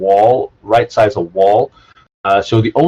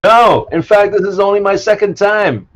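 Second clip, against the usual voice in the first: high NPC voice; 11 semitones higher than usual. Acted. Bartender NPC answers player.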